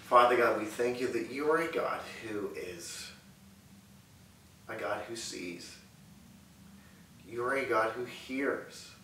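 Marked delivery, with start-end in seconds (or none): none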